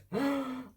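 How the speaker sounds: a high, startled intake of breath; real gasp of disbelief; sharp gasp. Real, not acted. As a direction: gasp as it goes. Manuscript just sent.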